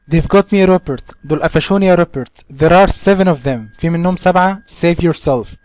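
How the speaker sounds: shouted loud and high, calling for help; desperate call. Acted. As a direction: yell the warning across the dark. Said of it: "a voice called out".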